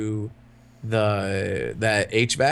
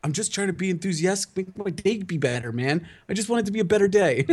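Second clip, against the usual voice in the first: mock earnest enthusiasm; 7 semitones higher than usual. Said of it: "And I'm like".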